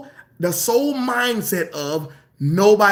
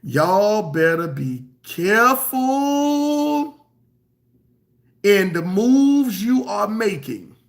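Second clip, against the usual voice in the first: very slow and deliberate, a little louder; slow reading; 3 dB louder, much slower than usual. Shown as a demonstration. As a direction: lay out each word slowly as a warning. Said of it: "I'm gonna read it slow for you".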